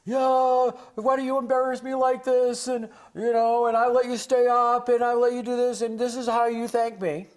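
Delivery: high, loud exasperated parent shouting; shrill-pitched angry parent yelling at a melting-down child. Retold or imitated. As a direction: scold the kid like an embarrassed, furious parent. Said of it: "the parent starts yelling at the child".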